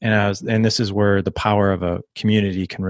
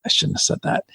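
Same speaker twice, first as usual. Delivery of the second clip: whispered self-reproach, retold; hushed, voiceless aside of remorse; regretful whisper; 4 dB quieter than usual. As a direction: whisper it to yourself as instant regret. Retelling his instant private regret.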